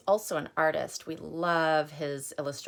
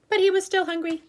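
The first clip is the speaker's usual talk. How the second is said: refrain up high; 14 semitones higher, 5 dB louder, faster than usual. Acted.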